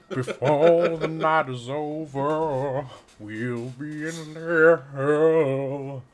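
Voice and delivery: slow, loud evil villain; drawn out like a gloating demon; sinister villain voice. Pretend. Mock sinister threat.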